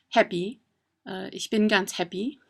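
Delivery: "happy" said the German way, like "heppy"; German pronunciation. Shown as a demonstration. Showing listeners an English word crept into German, German-style "happy". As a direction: say "happy" with an e as in "hep". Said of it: "German pronunciations and their own German meanings".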